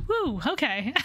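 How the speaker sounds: ordinary talk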